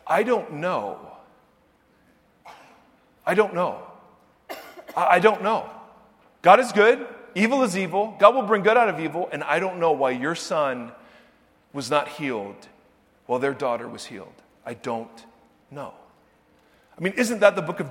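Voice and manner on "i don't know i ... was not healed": ordinary delivery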